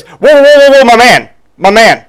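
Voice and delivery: high, loud whoa whoa, my man; hammed-up tough guy stepping in; mock macho husband. Husband butting in on the clerk, loud and high. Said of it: "he goes".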